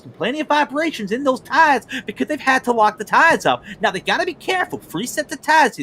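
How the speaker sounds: high-pitched, hammed-up racing analyst impression; Larry Mack. Commentator imitating a TV analyst explaining pit strategy, high and animated. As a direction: put on an excitable pundit voice, pitched high throughout. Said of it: "he just called my Larry Mack a Jeff".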